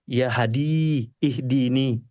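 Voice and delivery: "Ya Hadi, ihdini" murmured very slowly, falling; prayer in prostration. Shown as a demonstration. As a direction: draw out "Hadi" in a hushed, falling plea. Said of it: "Habis itu sujud lagi".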